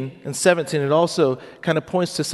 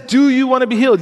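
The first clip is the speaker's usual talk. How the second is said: much higher and louder, eager offer; excited question; 7 semitones higher, 6 dB louder than usual. Acted. Preacher voicing Jesus offering healing, excited and loud. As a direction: ask it like the best news ever.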